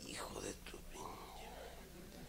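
hushed whispered swear; whispered curse. Retold or imitated. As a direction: whisper it under your breath.

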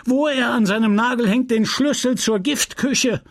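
the pharmacist fuming at his apprentice; a lightly voiced furious old apothecary; angry apothecary. Homais ranting at Justin.

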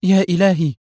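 high exclamation of disbelief; stunned cry. A narrator's reading. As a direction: cry out in shock. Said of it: "صرخت منى في ذهول". (صرخة ذهول)

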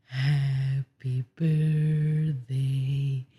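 usual speaking voice

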